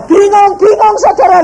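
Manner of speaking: high, loud, exasperated confusion; loud bewildered. Real, not acted. Preacher telling the congregation he was baffled, loud and high. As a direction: shout your bewilderment to the church.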